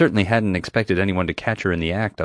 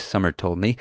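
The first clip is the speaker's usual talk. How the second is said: ordinary delivery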